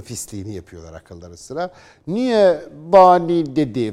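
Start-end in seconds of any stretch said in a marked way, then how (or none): 2.1-3.9 mocking critic's question.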